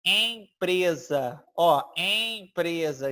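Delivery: EMPRESA very slow, first syllable held through the nose; drawn-out nasal em. Shown as a demonstration. Teacher shows air passing the nose, long nasal EM in empresa. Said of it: "esse em passou aqui pelo nariz".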